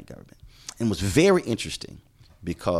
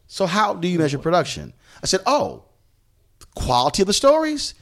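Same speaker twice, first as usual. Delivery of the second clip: high-pitched mock-innocent challenge; sarcastic questioning; 11 semitones higher, 5 dB louder than usual. Reporter pressing his editor on how production is measured, sarcastic. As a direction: play astonished to expose the editor's argument.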